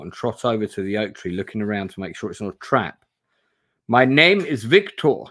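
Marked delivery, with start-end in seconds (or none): 3.9-5.2 higher, louder, slow pleading stranger; desperate Victor. Acted. Bound man introduces himself to the rider, desperate and slow. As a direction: beg to be believed, drawing it out. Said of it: "he replies desperately".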